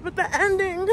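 on the edge of tears; real upset, nearly crying; voice breaking. Real, not acted. Upset over the movie ending.

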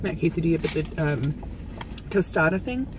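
chewing while talking; talking between bites; mouth partly full. Real, not acted. Seen 2: woman holds taco near mouth, just bit, jaw chewing while talking.

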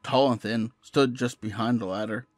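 horror story narration. A narrator's reading. Narrator recounting finding shadowy Polaroids on the bed, measured horror narration. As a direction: read a creepy tale with steady restraint.

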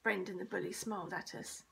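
usual speaking voice

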